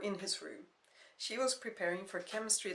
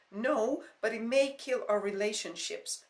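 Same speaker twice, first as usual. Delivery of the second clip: raised plea; 4 semitones higher, 8 dB louder than usual. Acted.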